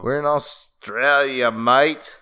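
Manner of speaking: loud, drawn-out mock Australian; Australian accent. Host showing off their best attempt, put-on Australian accent. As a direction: ham up a slow Australian accent. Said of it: "That's the best Australian accent".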